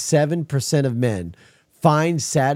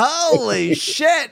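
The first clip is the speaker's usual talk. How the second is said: high, drawn-out disgusted surprise; mock shock; 14 semitones higher, much slower than usual. Host retelling smelling his own asparagus pee, mock shock. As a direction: recoil at the smell, hammed up. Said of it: "be like".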